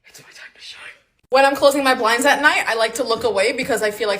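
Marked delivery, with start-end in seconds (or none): 0.1-0.8 soft secretive whisper. Acted. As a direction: lean in and breathe it out. Whispered intro before her list.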